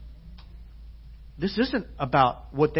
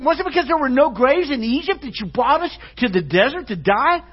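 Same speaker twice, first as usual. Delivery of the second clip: high, loud, mocking sarcastic complaint; big-time sarcasm; 9 semitones higher, 9 dB louder than usual. Israelites blaming Moses for the desert, exaggerated high sarcasm. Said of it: "big-time sarcasm".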